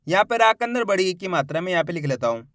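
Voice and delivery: ordinary delivery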